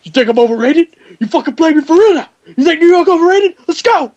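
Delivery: high, loud hyped-up taunt; hammed-up furious trash talk; mock yell. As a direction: imitate the winner yelling in his face. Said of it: "He's going like".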